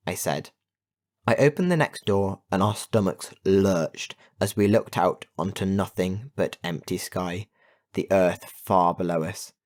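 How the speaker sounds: ordinary delivery, nothing marked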